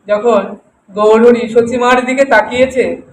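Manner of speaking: ordinary talk, nothing marked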